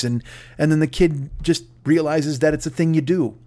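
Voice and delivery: usual speaking voice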